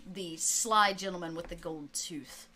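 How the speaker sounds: ordinary delivery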